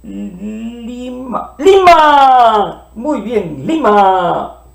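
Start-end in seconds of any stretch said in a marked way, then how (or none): 0.0-1.5 Lima said very softly; soft model word. Shown as a demonstration. Teacher models the word softly for the class. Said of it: "tal como hablamos". 1.6-2.9 Lima called out loud and high; loud model word. Shown as a demonstration. Teacher calls the word out to the children. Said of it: "tal como hablamos".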